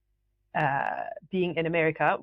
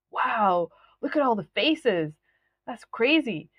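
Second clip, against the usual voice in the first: retold wonder, pitched higher; retold amazement; 5 semitones higher than usual. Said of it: "And I said out loud".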